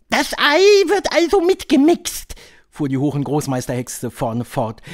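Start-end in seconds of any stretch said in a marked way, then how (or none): 0.1-2.3 high, loud witch voice; high-pitched, theatrical witch leader; Grand High Witch. A narrator's reading. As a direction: lecture the coven in a high, imperious voice.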